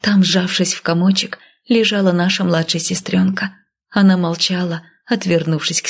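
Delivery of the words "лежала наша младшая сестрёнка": usual speaking voice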